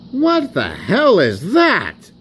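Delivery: high-pitched appalled wheeze; wheezed disbelief. A narrator's reading. Sir Handel reacts to Rusty, wheezing in disbelief. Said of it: "wheezed Sir Handel".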